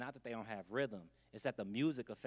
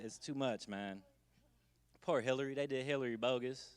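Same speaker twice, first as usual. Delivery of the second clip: usual speaking voice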